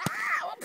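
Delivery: sharp loud yelp when hit; hit hard crying out. Real, not acted. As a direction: cry out as the snowball lands. Hit by a snowball.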